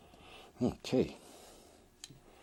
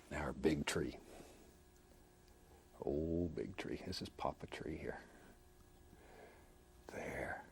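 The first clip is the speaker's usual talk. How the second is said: slow hushed whisper; 4 semitones lower, 9 dB quieter, slower than usual. Real, not acted. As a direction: whisper softly, taking your time. Naming the big tree.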